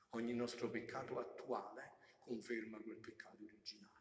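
very soft, low reflective delivery; hushed preaching. Real, not acted. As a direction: bring the congregation down to a hush.

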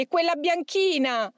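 high demonic cry; demon exclaiming. A narrator's reading. Demon pointing out a second saint, high cry. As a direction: call it out as an agitated demon. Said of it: "il demonio esclamò".

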